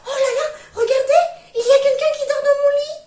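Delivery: very high and very loud, squealing and crying; little bear's small voice. Acted. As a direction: shriek it like an upset cub. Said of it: "de sa petite voix".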